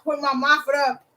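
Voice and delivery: ordinary delivery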